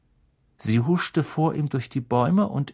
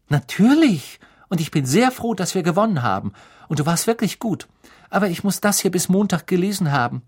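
put-on high-pitched girl's voice, insistent; earnest, flustered girl; 7 semitones higher, 4 dB louder than usual. A narrator's reading. Hermine defends herself to Harry over her reading, flustered and insistent. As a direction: answer emphatically in a higher voice.

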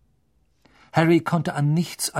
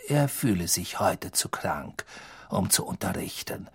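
cold menacing teacher; 5 semitones lower, 6 dB quieter than usual. A narrator's reading. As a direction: hiss it with icy contempt. Snape voice.

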